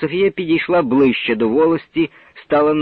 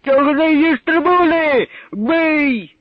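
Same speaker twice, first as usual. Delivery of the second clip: shrill-pitched shout, much higher; high furious yell; 12 semitones higher, 3 dB louder, slower than usual. Acted. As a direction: egg the crowd to violence. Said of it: "вигукував".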